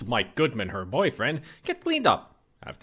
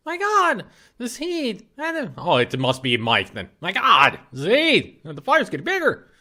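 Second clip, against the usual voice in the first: panicked; 7 semitones higher than usual. Acted. Ghost catches fire.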